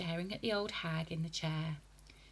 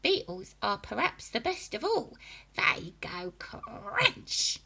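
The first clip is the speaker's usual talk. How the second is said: witchy grandma voice; 6 semitones higher, 4 dB louder than usual. A narrator's reading. Grandma describing bugs.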